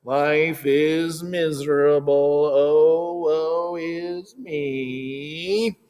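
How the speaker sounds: slow, drawn-out mock moaning; mock woe-is-me. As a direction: ham up the miserable sigh of self-pity. Mopey self-pity she mocks.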